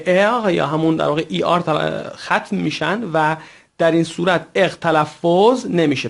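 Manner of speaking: ordinary delivery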